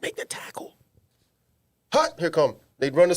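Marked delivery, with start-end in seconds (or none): 0.0-0.7 hushed sideline whisper. Retold or imitated. Telling number sixteen a secret tip, whispered.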